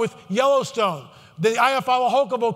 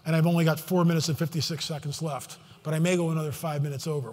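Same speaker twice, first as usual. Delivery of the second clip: low, hushed voice; 5 semitones lower, 7 dB quieter than usual. Real, not acted. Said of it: "the reason why I'm talking like this".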